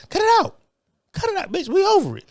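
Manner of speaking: high, sharp cut it out; fed up, telling her to stop; ranting at Kamala Harris to quit, high and fed up; fed-up outburst. Real, not acted.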